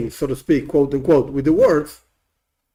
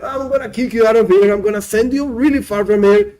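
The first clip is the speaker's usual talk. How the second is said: angry banishing; 5 semitones higher than usual. Acted. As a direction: hurl him out of Eden. God banishing man.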